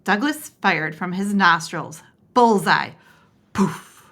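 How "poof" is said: plain reading voice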